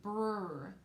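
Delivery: rolled "brr" blend sound; isolated "brr" blend. Shown as a demonstration. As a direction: buzz the "brr" blend on its own. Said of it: "So listen".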